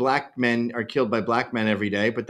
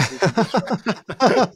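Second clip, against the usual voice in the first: mock desperate plea; 6 semitones higher than usual. Guest retelling his dread about Australian bands, rising mock wail. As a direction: let it climb into a hammed-up cry. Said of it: "And I caught myself going".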